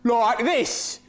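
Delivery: high, exaggerated Australian twang; mock Australian accent. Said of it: "always going to sound like that".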